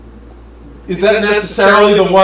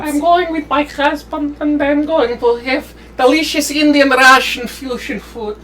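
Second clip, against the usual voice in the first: Russian woman voice; 6 semitones higher, 3 dB quieter than usual. Acted. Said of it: "I'll just be all the characters".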